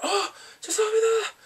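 very high, loud mock wail; acted outcry. Department leader hit by a kicked can, crying out. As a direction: yelp it out like someone just hit.

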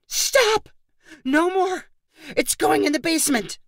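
high, frantic shouting at a toy; aunt at the end of her rope shouting; exasperated yell. A narrator's reading. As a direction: yell it as if your sanity just cracked. Megan snaps at the noisy toy.

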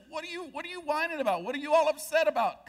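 hammed-up prophet rolling his eyes at the king; mock Elisha scolding. Elisha scolding the whining king, high and fast.

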